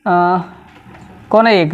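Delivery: normal talking voice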